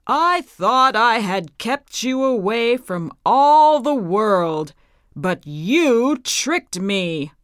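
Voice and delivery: put-on a wicked witch raging at the girl; angry witch. A narrator's reading. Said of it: "cried the witch".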